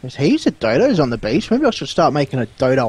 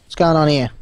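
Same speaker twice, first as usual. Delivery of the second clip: ordinary talk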